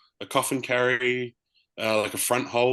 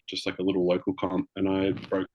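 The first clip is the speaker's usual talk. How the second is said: ordinary talk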